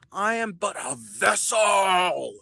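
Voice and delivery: slow, grand proclamation; grandiose. Pretend. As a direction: intone it like a prophet.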